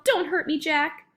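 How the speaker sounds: high-pitched frightened plea; pleading yell. A narrator's reading. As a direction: cry out begging not to be hurt. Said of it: "he yelled".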